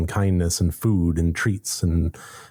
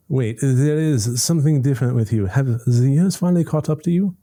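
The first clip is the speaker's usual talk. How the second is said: put-on higher, haughty French-accented cat; 7 semitones higher, 3 dB louder than usual. The cat Frau Frau sizing up the visitor, in a higher French accent. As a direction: sniff it out in a French accent, looking down on them. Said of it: "I apologize for my accent".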